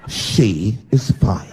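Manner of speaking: slow, low, pointed rebuke; indignant correction. Acted. Friends correct a nosy stranger, slow and indignant. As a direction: draw it out slowly with offended dignity. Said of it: "looked at me like I was evil".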